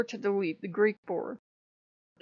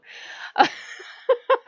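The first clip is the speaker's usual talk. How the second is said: high unvoiced giggle; a nervous high-pitched laugh; high breathless laugh; 5 dB louder than usual. Real, not acted. Laughing nervously at her own fear.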